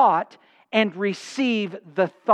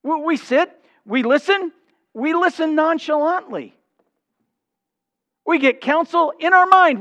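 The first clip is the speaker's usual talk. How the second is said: raised, emphatic sermon delivery; higher-pitched, insistent pulpit proclamation; forceful preaching; 8 semitones higher, 4 dB louder than usual. Real, not acted. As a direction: preach it high and driving.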